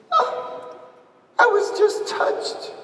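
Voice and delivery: put-on high, breathy and slow, a teary girl's answer; tearful girl explaining she was moved; crying girl. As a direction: let it crack through tears.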